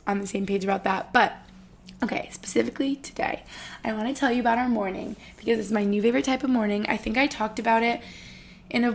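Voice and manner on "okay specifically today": soft whispered lead-in. Real, not acted. Vlogger whispers to her viewers as she changes topic. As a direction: lean in and whisper the setup.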